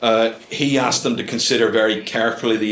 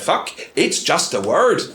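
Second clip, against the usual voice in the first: retold curse; 6 semitones higher than usual. Man trips over in street, curses.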